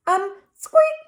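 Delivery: high little squeak; tiny mouse squeak; mock squeak. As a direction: squeak it out nervously.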